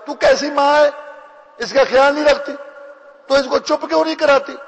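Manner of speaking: high, loud angry scolding; angry rebuke. Retold or imitated. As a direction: lash out at a careless mother. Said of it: "غصے میں آ گیا".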